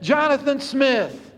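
high, drawn-out calls of the name; a father crying out for his wounded son; calling out again. A narrator's reading. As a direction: call out as if searching the dark. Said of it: "he'd cry out again".

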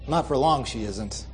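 ordinary delivery

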